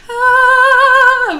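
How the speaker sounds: piercing high sung scream; ear-splitting sustained high scream; glass-shattering scream. Shown as a demonstration. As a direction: let out a long shattering shriek. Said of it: "really loud breaking glass".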